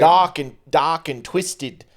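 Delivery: put-on slow "dark and twisted" in a failed Australian accent; attempted Australian accent, still English. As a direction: drag it out, going for Australian. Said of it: "you're still doing English".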